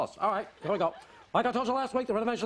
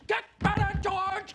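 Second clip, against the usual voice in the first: emphatic farewell; 12 semitones higher, slower than usual. Acted. Boss urging George to recover.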